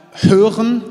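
sudden loud high burst on one word; loud emphasis. Real, not acted. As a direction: hit hören hard like a call to the crowd.

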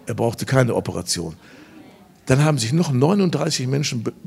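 ordinary delivery

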